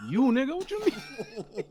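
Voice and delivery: high, slow disbelief; incredulous high squeal. Real, not acted. Incredulous reaction.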